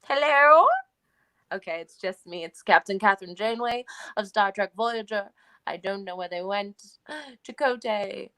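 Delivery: put-on stranded captain in an Iowa-British accent; Captain Janeway. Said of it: "can you only do her introducing herself?".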